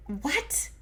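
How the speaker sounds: high, soft, incredulous squeak; quietly baffled imitation; mock bewilderment. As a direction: ask it small and high, in disbelief. Acting out her bewildered reaction.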